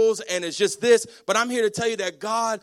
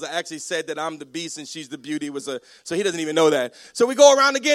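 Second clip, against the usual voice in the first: normal talking voice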